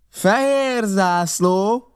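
high, slow drawn-out call. A narrator's reading. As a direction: stretch it out across the distance. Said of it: "kiáltott Silver".

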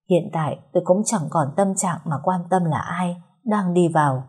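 ordinary delivery, nothing marked

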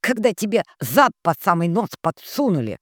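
snorting sister. Acted. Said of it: "фыркнула Ноет".

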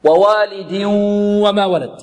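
ووالد وما recited with a held nasal hum into the و; idgham with ghunnah. Shown as a demonstration. Teacher recites the verse, merging with ghunnah. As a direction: merge ووالد into وما through the nose. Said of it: "غنة، إدغام بغنة".

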